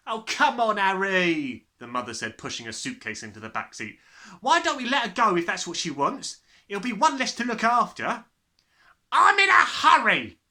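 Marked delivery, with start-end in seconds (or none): 0.1-1.7 loud, high, careless mother; a brash mother packing the car. A narrator's reading. Mother nagging husband. 4.4-8.2 loud, high, careless mother; a brash mother packing the car. A narrator's reading. Mother shrugging off daughter. 9.1-10.4 very loud impatient shout; a father in a rush. A narrator's reading.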